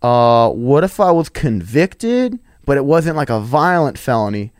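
slow, hesitant mock customer; struggling to answer. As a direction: drag it out, hedging as you ask. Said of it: "struggle on it".

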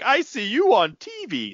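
high, slow, dopey fan voice; mock gawking fan. Clueless fan meeting a player from television, high mock voice. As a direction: say it high and drawn out, mocking. Mocking fans' empty reaction.

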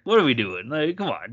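normal talking voice

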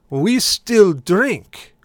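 very slow, loud, high declaration; bride's father's voice. Retold or imitated. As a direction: repeat it emphatically, stretching each word. Sylvester repeats himself to brother.

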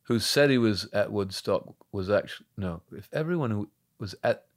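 put-on lightly done London punk English accent; quoting his old character in a light London English accent. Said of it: "That's such a good accent".

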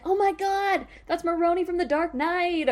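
imitating a fan's thrilled gasp; gushing fan. Commenters recognizing the actor, a high excited fan voice. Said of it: "people being like".

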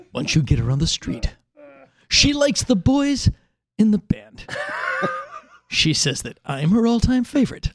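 lightly done measured Twilight Zone narrator; a hint of Rod Serling's clipped, ominous delivery; mild Serling-style intro read; Rod Serling cadence. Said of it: "in Rod Serling's cadence".

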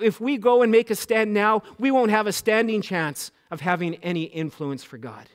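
loud, high, impassioned preaching; quoting the MLA's warning in a full-force sermon voice. Acted. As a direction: drive the warning home like a revival preacher. Preaching continues.

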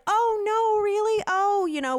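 high, loud, flustered apology; hammed-up self-conscious oh-no; profusely apologetic. Dog owner apologizes to older couple, flustered. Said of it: "apologized profusely".

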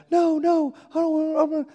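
high-pitched frantic protest; mock panicked wife. Wife protesting to her husband, high and panicked. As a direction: squeal it out like a panicked mom. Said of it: "My wife's freaking out".

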